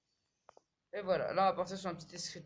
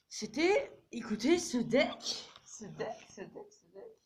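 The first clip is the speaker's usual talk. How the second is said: high, slow theatrical voice; hamming up praise of the deck; mock pompous voice; 9 semitones higher, 5 dB louder, slower than usual. Streamer hams up praise of his deck, high and slow.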